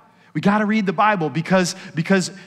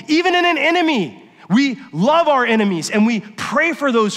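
fervent preaching; 5 semitones higher than usual. Acted. Pastor preaching at full force.

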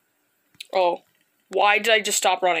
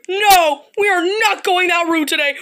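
frightened shout; 7 semitones higher, 5 dB louder than usual. Real, not acted. Panicked refusal during scare.

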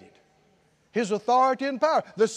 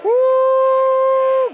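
long, high, loud "woo"; exultant whoop; 12 semitones higher, 9 dB louder than usual. Real, not acted. Preacher rejoices before the congregation, a loud jubilant whoop. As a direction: let out a held, high whoop.

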